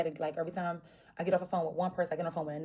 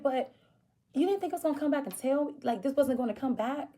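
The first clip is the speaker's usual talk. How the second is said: much higher, fast, incredulous scolding; exasperated outrage; 7 semitones higher, 3 dB louder than usual. Retold or imitated. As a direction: throw his excuse back at him. Arguing with boyfriend on phone.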